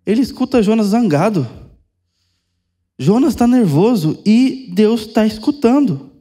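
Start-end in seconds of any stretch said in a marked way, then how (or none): none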